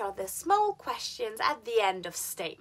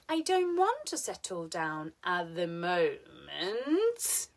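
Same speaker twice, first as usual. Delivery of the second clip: MOMENT stretched, dipping then rising; slow, leave "moment" hanging upward; unfinished rise on "moment", a but to come; fall-rise intonation; 2 semitones lower, slower than usual. Shown as a demonstration. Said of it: "This intonation is used at the end of statements".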